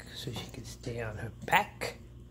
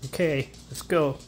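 louder call to the dog; 2 semitones higher, 7 dB louder than usual. Real, not acted. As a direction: call her, a little raised. Calling dog to bed.

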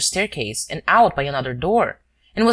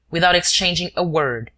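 ordinary delivery

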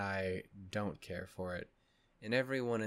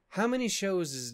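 higher and louder, exasperated; 10 semitones higher, 6 dB louder than usual. Real, not acted. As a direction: throw it up in disbelief. Exasperated question.

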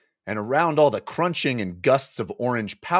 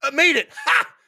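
high, louder exultant cry; triumphant cheer; 4 dB louder than usual. Acted. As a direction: cheer the made save. Player celebrating save.

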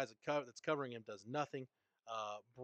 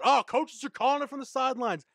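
much higher, louder sneering quote; mocking critics; 11 semitones higher, 9 dB louder than usual. Host mocking football critics, high sneer. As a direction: parody the critics' complaint.

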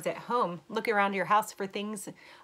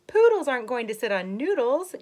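gasped; 5 semitones higher, 11 dB louder than usual. Acted. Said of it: "gasped the dog".

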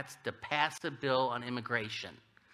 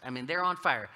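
ordinary talk

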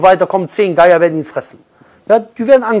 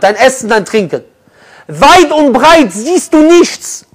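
raised, louder, slower scene-painting; dramatic preaching; 4 semitones higher, 6 dB louder than usual. Acted. Preacher making the congregation picture the lost camel, raised dramatic preaching. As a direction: paint the desert so they feel the thirst.

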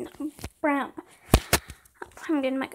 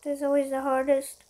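ordinary talk, nothing marked

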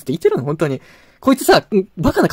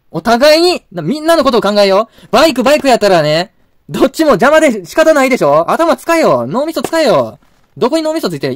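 raised, heated scolding; scolding the neighbour who boxed in his bike, furious; angry rant; 6 semitones higher, 5 dB louder than usual. Real, not acted. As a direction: chew out the rude neighbour, loud and high.

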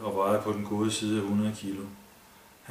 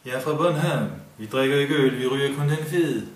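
put-on Kurt in a higher, louder Bornholm accent; 5 semitones higher, 6 dB louder than usual. A narrator's reading. Kurt teases the drunk man in Bornholm accent. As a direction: tease in thick Bornholm dialect. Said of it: "Han talte med den bornholmske accent".

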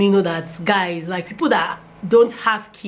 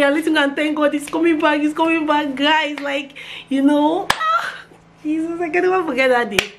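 usual speaking voice

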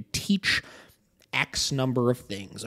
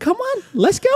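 very high, loud coaxing squeak to a scared dog; a mocking sing-song falsetto for a frightened pup; Mickey Mouse voice; 8 dB louder than usual. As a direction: coo at the dog like a cartoon mouse. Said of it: "give them the Mickey Mouse voice".

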